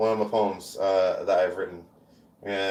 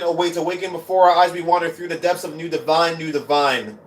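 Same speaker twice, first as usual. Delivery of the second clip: higher, louder incantatory recital; spoken-word poem; 8 semitones higher, 7 dB louder than usual. Acted. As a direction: declaim it in rolling, repeated rhythm. Performs a poem.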